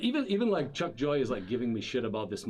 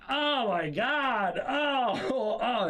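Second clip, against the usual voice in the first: high, slow mock wail of pain; 11 semitones higher, much slower than usual. [name] imitates himself after falling on the planter, high drawn-out wail. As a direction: ham up the hurt moaning. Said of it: "and I'm like".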